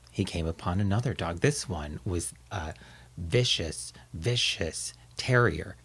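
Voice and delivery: ordinary delivery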